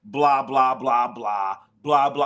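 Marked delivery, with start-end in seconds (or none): none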